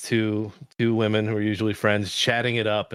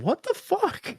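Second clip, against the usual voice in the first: appalled disbelief; slower than usual. Real, not acted. Outraged at giggling hosts.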